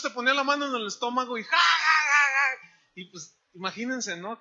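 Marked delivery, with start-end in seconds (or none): 1.4-2.9 loud, high put-on laughter; imitated mocking laugh. Healer lays hand on a stomach, imitated loud laugh. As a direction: burst into a hammed-up "ha ha". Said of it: "riéndome de las enfermedades".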